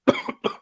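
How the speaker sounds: sudden hard cough; coughing fit. Real, not acted. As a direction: cough like something caught in the throat. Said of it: "I'm choking".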